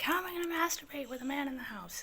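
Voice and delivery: high-pitched mock panic; freaking out. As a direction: wail it as a comic worry. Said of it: "freaking out".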